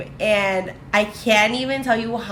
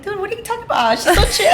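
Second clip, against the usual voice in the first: much higher, laughing disbelief; can't believe what they're saying; incredulous laughing protest; 12 semitones higher, 4 dB louder, faster than usual. Retold or imitated. Defending calm Myra to the other girls, laughing disbelief.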